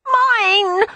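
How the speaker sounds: put-on a baby clutching his prize; toddler cry. Toddler cries out refusing to give up his biscuit. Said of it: "Edward cried".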